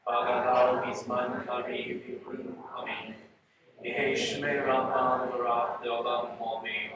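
measured, even-toned Kaddish recitation; prayer recitation. Real, not acted.

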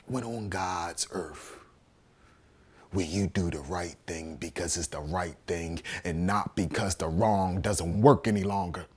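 low, hushed moral question; near-whispered start, soft low plea; quiet appeal. Acted. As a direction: ask it softly, from deep down. Pleading question after outburst.

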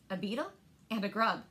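ordinary delivery

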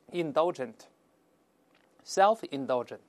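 drawn-out, careful dictation of each word; slow model reading. Shown as a demonstration. Teacher reads the adjectives aloud for students, slow model reading. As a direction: read slowly and clearly for learners. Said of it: "我们先读一下".

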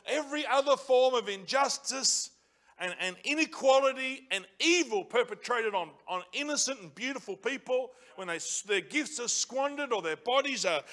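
loud, rising sermon cry; preaching at full force. Acted. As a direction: preach it hard, building to the questions. Preacher building to a rousing peak.

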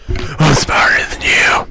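slow, forced stage whisper; slow breathy shouted whisper. Shown as a demonstration. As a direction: hiss it hard and slow like a threat. Repeats line in several takes.

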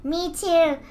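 normal talking voice

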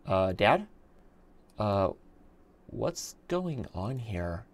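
slow, soft little-kid imitation; six-year-old daughter. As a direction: imitate a child, softly stretching it out. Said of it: "my six-year-old daughter".

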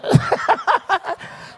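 high unvoiced chuckle; breathy laugh. Real, not acted. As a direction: laugh it off in disbelief. Preacher laughs at the request.

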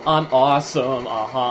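ordinary delivery

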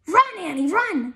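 high, loud warning shout; urgent cry. A narrator's reading. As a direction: cry out to make someone flee. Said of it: "cried Jack".

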